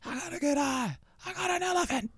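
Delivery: very high, strained hardcore rap imitation; high-pitched mock hardcore vocal; hardcore rapper. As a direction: mimic the hardcore song way up high. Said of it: "like, hardcore when you hear it".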